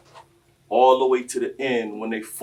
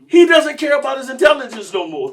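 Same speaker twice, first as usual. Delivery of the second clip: ordinary talk, nothing marked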